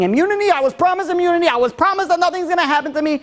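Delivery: high-pitched, frantic protest; panicked wail, held high without full volume; mock screaming. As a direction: act out a prisoner crying out for protection. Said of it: "the prisoner is screaming".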